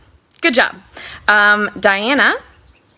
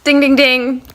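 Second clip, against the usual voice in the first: higher-pitched game show ding; imitated bell; 5 semitones higher than usual. Host rewarding a right guess in the game, imitated bell. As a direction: voice the correct-answer bell.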